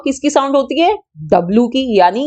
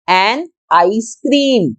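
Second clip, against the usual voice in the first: slow teaching demo; much slower than usual. Shown as a demonstration. Teacher slowly sounding out "An ice cream" for her students. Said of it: "आई की sound है आई".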